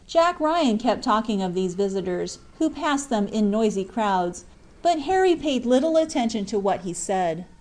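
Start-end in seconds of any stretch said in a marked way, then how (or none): none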